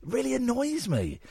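high, swooping exasperation; annoyed put-on voice. Shown as a demonstration. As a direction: pitch it up in mock irritation. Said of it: "when I do this voice".

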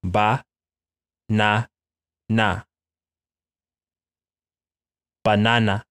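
Ba-na-na slowly syllable by syllable, open ah vowels; Spanish banana. Shown as a demonstration. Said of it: "una banana".

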